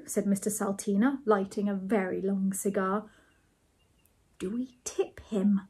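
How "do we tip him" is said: slow, whispered question; quiet. A narrator's reading. Ethel asks about tipping, quiet whisper. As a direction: whisper it slowly and discreetly. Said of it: "asked Ethel quietly".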